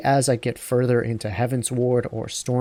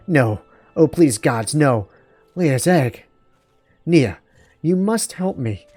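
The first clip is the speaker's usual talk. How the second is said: louder, pleading character voice; distraught game character; 3 semitones higher, 3 dB louder than usual. Acted. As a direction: plead in hammed-up distress over a loss.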